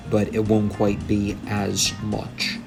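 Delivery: ordinary talk, nothing marked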